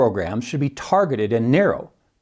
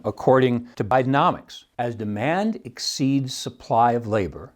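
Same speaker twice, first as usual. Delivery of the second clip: usual speaking voice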